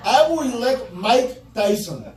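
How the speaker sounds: usual speaking voice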